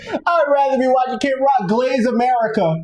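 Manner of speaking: high-pitched mocking disbelief; incredulous mock quote. Mocking people who'd rather watch [name], high and incredulous. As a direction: pitch it up, scoffing at the idea.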